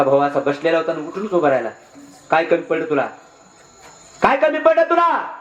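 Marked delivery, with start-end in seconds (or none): 4.2-5.3 much higher, wailing challenge; indignant outcry. Acted. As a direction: cry it out in hurt outrage at your brother. Elder brother jumps up, protests.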